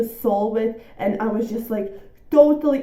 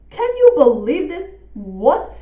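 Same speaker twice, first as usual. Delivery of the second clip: amazed; 4 semitones higher, 6 dB louder than usual. Real, not acted. Unexpected rating estimate.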